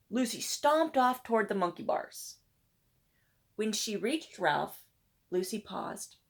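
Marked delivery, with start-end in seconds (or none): none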